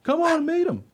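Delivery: high, loud, eager dog voice; friendly dog character. Acted. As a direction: call up cheerfully as a very high-pitched dog. Dog answers from the tree.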